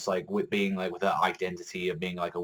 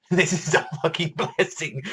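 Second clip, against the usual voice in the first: laughing; 7 semitones higher than usual. Retold or imitated. Laughs over the quoted line.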